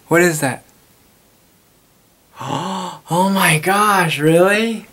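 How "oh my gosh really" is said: gasping, then a higher, louder, slow oh my gosh; hammed-up shocked schoolgirl; mock scandalized surprise. Shocked at Seven Minutes in Heaven.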